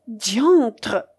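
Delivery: plain reading voice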